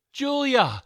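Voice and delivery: high, loud name call; shout. A narrator's reading. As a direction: shout her name together. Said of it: "Christy and I shouted in unison".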